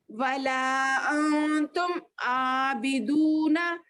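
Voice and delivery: drawn-out recited verse; slow recitation. Shown as a demonstration. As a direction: recite each syllable long and even. Teacher recites verse slowly. (धीमी तिलावत)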